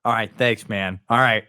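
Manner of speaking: ordinary talk, nothing marked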